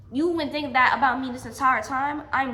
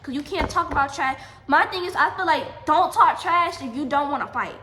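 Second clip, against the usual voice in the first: loud, higher-pitched retold dressing-down; heated challenge; 3 semitones higher, 7 dB louder than usual. Girl telling ex-friend not to talk trash, heated challenge. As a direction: hammer out the warning like a challenge.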